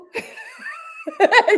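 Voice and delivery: high, loud laugh bursting out; loud burst of laughter. Real, not acted. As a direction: let the laugh break out loud.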